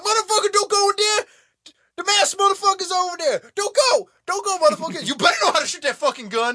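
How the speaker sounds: shrill-free, very high and loud panicked yelling; screaming at screen. Retold or imitated. Viewer screaming at the character not to go in there, very high and loud. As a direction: scream a warning at the movie screen. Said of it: "I'm, like, screaming at the screen".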